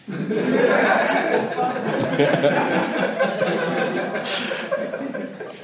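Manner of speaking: long high wheezing laugh; breathy, drawn-out fit of laughter; helpless laughter. Real, not acted. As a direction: crack up and keep going. Breaks up at his own joke.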